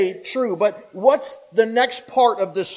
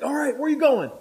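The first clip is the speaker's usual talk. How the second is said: higher mock question; curious prisoner; 8 semitones higher, faster than usual. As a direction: ask up high, puzzled. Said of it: "they're like".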